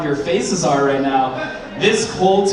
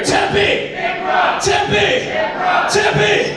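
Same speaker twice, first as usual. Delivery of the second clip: high shouted crowd chant; call and response; much slower than usual. Acted. Comic leading audience call-and-response, shouted chant. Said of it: "This side over here".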